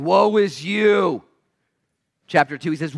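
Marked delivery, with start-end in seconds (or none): 0.0-1.2 high, loud, very slow drawn-out cry; fired-up prophet. Acted. Isaiah telling everyone off, fired-up prophet cry. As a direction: bellow it slowly like a prophet on fire. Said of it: "He's pretty fired up".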